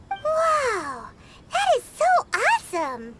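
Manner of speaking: high, bright-eyed wow of wonder; amazed. Acted. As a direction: gush with delight at the secret. Amazed by family recipe trick.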